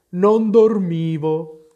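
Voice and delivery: sleepy croak, stretched out; hoarse, feeble. A narrator's reading. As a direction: drag it out hoarsely, as if just woken. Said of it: "disse con voce roca, flebile".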